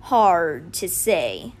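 very slow, low cheetah voice; slow, noncommittal big cat; big cat character. A narrator's reading. Cheetah answers the children.

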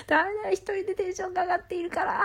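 high, rushed, giddy fluster; real excitement spilling over; overexcited. Real, not acted. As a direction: rush it high, ending in a cry.

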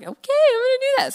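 quick, high, eager agreement; eager yes. Retold or imitated. Young girl eagerly giving in to temptation, quick and high.

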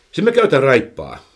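usual speaking voice

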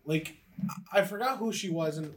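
usual speaking voice

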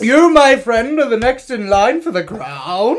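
dying king's decree. Acted. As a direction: announce the heir like a grand old king, laughing through it. Plays Edward naming his heir.

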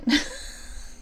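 a quick breathy giggle; high airy laugh. Real, not acted. After joking about forgetting, airy giggle. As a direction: giggle at your own joke.

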